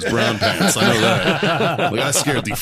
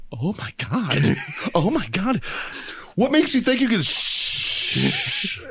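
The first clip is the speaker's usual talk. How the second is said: mock girl voice, rising much higher; 8 semitones higher, much slower than usual. Girl protests as Marine berates her date, imitated appalled voice.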